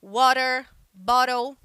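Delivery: water, bottle with the T flapped like a quick R; American English flapped T; American pronunciation. Shown as a demonstration. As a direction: soften the T into a tap, American style. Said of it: "ele quase vira um R".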